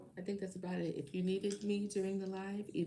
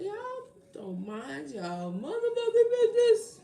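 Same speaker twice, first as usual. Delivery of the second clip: high, loud comic outburst; exasperated comedian-style shout; Kevin Hart; 13 semitones higher, 11 dB louder, slower than usual. Acted. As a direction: yell it drawn out at a raised pitch. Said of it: "Like Kevin Hart".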